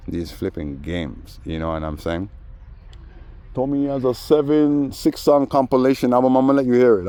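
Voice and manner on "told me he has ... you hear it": mock boasting. Mocking the man's boasting.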